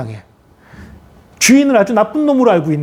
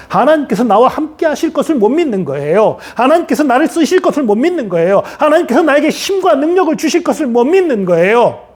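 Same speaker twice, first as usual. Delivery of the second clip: high, driving sermon crescendo; forceful preaching; 8 semitones higher than usual. Real, not acted. Preacher exposing the congregation's unbelief, forceful preaching. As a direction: hammer each point home with rising pitch.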